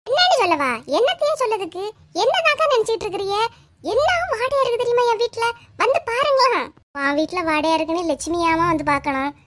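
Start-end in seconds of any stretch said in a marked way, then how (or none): 0.1-6.6 high-pitched indignant outburst; upset neighbour scolding over the smell; angry complaint. Acted. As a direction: confront her, voice climbing. Angry neighbour confronts Lakshmi.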